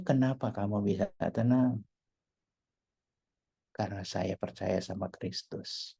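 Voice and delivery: soft, low confiding exchange; hushed conversation. Acted. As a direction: talk it over quietly, almost privately. Percakapan pribadi soal ketenangan. (percakapan pelan dan lembut)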